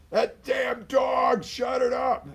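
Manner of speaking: very high, loud, slow yell at the dog; angry shout. Retold or imitated. Fed-up owner yelling about the dog, a loud angry shout. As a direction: bellow at the barking dog in a rage. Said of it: "Two years later".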